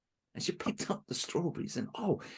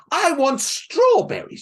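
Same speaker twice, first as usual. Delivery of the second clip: high, loud, slow whiny demand; spoiled girl demanding; 14 dB louder, slower than usual. Acted. Spoiled Helen demanding strawberries, high whiny wail. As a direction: whine it out like a spoiled brat.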